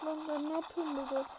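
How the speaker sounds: normal talking voice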